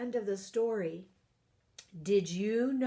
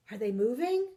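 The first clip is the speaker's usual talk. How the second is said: plain reading voice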